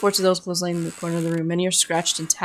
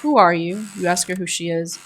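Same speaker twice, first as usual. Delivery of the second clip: normal talking voice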